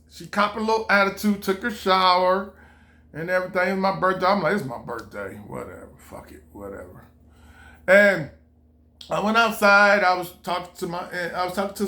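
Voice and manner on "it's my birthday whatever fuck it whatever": much softer, grumbled under the breath; muttered to himself. Retold or imitated. Irritated husband grumbling to himself, much softer. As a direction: mutter it so she can't hear.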